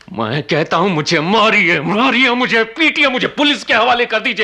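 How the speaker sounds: rising desperate plea. Acted. Killer pleading with the uncle to punish him, rising desperation.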